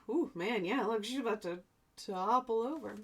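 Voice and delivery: usual speaking voice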